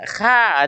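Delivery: KHA with the tongue dropped; thin kha. Shown as a demonstration. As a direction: say "kha" light and open. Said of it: "lidah kita ini turun ke bawah".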